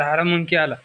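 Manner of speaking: usual speaking voice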